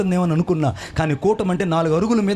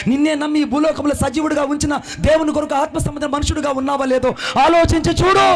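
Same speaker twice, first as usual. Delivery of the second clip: building to a shout; 5 semitones higher than usual. Real, not acted. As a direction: build and hit the end hard.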